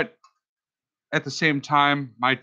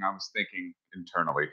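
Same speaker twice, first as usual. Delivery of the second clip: mumbled aside; 8 semitones lower, 10 dB quieter, faster than usual. Real, not acted. Quietly insisting he's calm.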